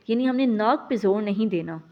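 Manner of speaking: ordinary talk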